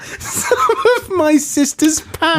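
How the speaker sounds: high, slow, laughing disbelief; laughing repeat. Real, not acted. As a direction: savour the words through laughter. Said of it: "Say that again".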